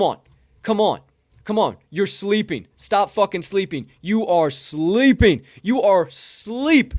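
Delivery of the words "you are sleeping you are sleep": slow, loud, every word hit; slow emphatic scold. Real, not acted. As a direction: drag it out to drive it home. Scolding viewers who ignore project.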